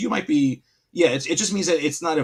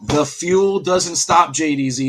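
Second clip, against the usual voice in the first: hyped; 5 semitones higher, 6 dB louder than usual. Real, not acted. Hype call over big play.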